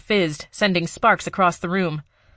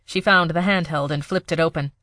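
ordinary delivery, nothing marked

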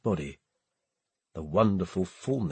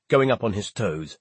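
plain reading voice

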